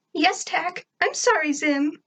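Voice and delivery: high, put-on child voice; high little character apology; apologizing schoolgirl. Sarah's cowed apology, high voice.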